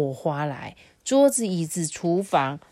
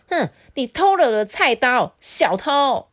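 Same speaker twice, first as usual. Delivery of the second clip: higher and louder, punching 偷 and 小偷; angry roar back; 8 semitones higher, 7 dB louder than usual. Acted. Younger brother shouting back at his brother, just as furious. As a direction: roar it back without backing down. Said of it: "不甘示弱的怒吼说".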